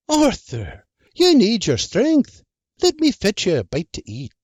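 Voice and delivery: high, teasing farm wife voice; sharp Irish wife. Acted. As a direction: needle him with sly wit. Wife character voice.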